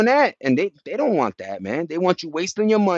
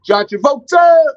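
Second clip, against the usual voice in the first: very high, loud name call; hyped shout; 12 semitones higher, 11 dB louder than usual. Shown as a demonstration. Streamer calling out John Travolta, loud high. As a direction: yell his name like a hype man.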